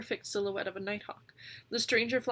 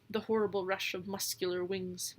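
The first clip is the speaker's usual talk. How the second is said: usual speaking voice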